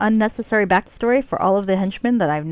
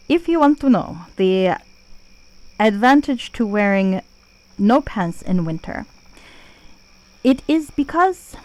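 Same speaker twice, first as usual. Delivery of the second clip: terrible Russian Jamaican accent; 2 semitones higher than usual. Shown as a demonstration. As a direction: fake a clumsy Russian-Jamaican lilt on purpose. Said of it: "you can imagine it with the accent".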